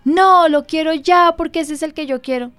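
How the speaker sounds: put-on spoiled little boy demanding his toy; mock whiny child. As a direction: act out a kid insisting on a toy. Parent retelling son's demand.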